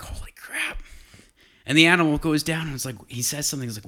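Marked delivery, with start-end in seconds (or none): 0.0-0.8 breathless whispered awe; hushed, stunned whisper; awed whisper. Retold or imitated. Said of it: "being a little bit like".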